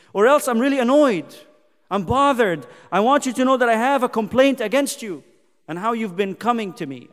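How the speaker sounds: loud, emphatic sermon delivery; full-force preaching. Acted. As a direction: preach it as God's stern demand.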